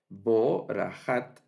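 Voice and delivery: borajat drawn out slowly, syllable by syllable; borajat, stretched slowly with a clear j; slow model reading. Shown as a demonstration. Teacher models borajat slowly for the class. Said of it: "y vamos a leer".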